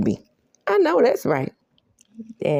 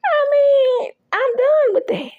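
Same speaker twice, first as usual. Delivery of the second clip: much higher, louder sassy woman's voice; high mock woman's voice; 5 dB louder, slower than usual. Recapping the show, she voices the fed-up woman: high and sassy. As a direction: squeak it out like a fed-up diva.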